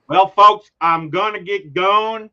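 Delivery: a New Yorker faking a Southern farewell; Southern accent. Retold or imitated. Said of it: "I can just hear his accent".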